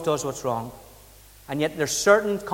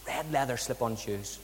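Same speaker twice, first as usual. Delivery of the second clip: much softer, savouring "Red leather slip-on shoes"; quiet repeat; 4 semitones lower, 10 dB quieter than usual. Shown as a demonstration. Preacher repeating the brother's shoes for the congregation, softly.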